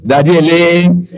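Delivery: drawn-out call to daddy; a slow, sing-song greeting to the caller. Acted. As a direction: stretch it out, calling daddy. Calling out to caller.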